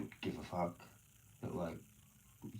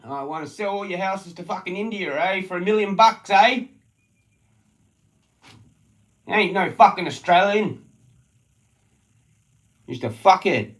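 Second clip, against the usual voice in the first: high, loud raging tirade; angry shouting rant; 10 semitones higher, 14 dB louder than usual. Real, not acted. Ranting at the locals about selling houses, angry shout. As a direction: keep shouting it out.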